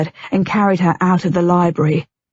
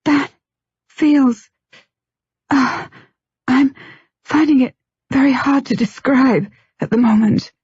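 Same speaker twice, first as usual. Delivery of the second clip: higher, halting, breathless words; 6 semitones higher than usual. A narrator's reading. Wife unable to describe the feeling, in a halting, breathless voice. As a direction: lose the thread as you speak.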